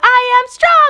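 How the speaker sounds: high, loud echoed shout; crowd roaring back. Retold or imitated.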